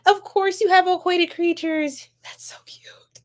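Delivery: hushed whisper, tender; whispered aside. Real, not acted. Streamer whispering to a viewer about aquatic pets, hushed. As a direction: whisper it like a secret delight.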